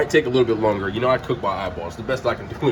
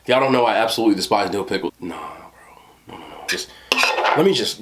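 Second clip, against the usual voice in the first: normal talking voice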